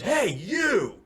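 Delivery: high mock holler; retold yell. Storyteller as stranger calling him out from down the road, mock yell. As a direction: imitate a stranger yelling from afar. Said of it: "he yells at me".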